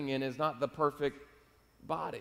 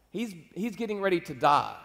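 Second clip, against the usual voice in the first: normal talking voice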